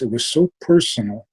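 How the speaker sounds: ordinary talk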